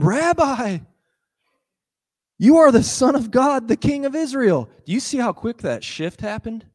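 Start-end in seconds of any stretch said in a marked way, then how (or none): 0.0-4.7 much higher, louder awed exclamation; exclaiming. Acted. Nathanael suddenly declaring Jesus the Son of God, high and loud. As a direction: proclaim it in sudden awe. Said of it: "He exclaimed".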